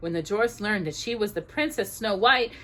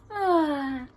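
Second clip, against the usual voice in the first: light high waking sigh; 7 semitones higher than usual. A narrator's reading. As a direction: wake gently as a princess.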